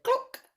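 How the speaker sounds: high, loud cluck; sudden high-pitched cluck; hen cluck. A narrator's reading. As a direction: imitate a hen with one sharp cluck. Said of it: "and the hen said".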